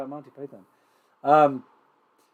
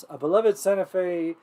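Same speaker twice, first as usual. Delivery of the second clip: ordinary delivery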